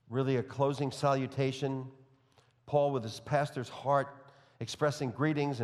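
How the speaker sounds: ordinary delivery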